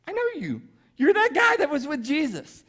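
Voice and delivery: high-pitched voice of someone spotting a culprit; high, acted "I recognise you"; accusing bystander.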